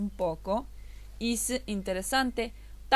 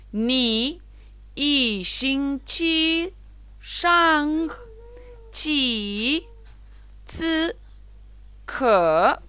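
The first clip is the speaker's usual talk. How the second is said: "yi xin chi shang chi zi ke" very slow and louder, syllable by syllable; slow second reading; 4 semitones higher, 4 dB louder, much slower than usual. Shown as a demonstration. Teacher rereads the sentence slowly for students. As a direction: read it again slowly, word by word. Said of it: "Vamos de vuelta".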